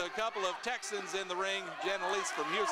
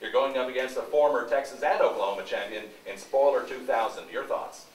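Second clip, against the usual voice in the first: loud hot mic backstage interview; booming close mic interview; 9 semitones lower, 10 dB louder than usual. Real, not acted.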